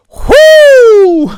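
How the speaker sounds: very high, drawn-out "whoo"; loud whoop. Retold or imitated. Whooping among people at the bar, high and loud. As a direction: let out a long piercing party whoop.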